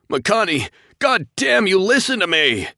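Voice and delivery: very high, loud desperate scream; desperate shout. A narrator's reading. Captain screaming at doomed pilot, desperate. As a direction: scream at him to listen.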